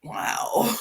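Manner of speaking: breathy, awed wow; whispered wow. Retold or imitated. After Kim exposes Rob, a stunned breathy wow. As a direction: let it out in stunned disbelief. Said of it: "And it was just like".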